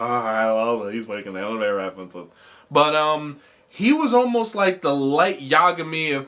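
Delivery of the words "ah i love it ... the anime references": lower, muffled nerd voice mumbling his glee; mock a fan who loves the anime references; lightly voiced gushing nerd. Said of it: "which I know nerds out there like".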